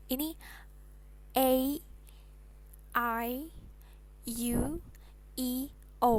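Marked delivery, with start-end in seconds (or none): 1.4-6.2 "A, I, U, E, O" said ay, eye, you, ee, oh, spaced apart; English vowel names. Shown as a demonstration. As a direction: recite "A, I, U, E, O" as English letter names. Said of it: "kalau dalam bahasa Inggris".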